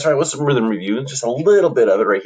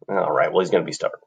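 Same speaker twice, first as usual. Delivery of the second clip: ordinary talk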